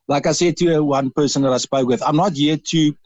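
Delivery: usual speaking voice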